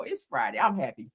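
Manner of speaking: ordinary talk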